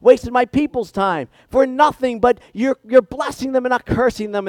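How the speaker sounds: king berates Balaam in a high, loud rant; furious king. Acted. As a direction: vent like a cheated ruler. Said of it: "He's so mad. He's fuming".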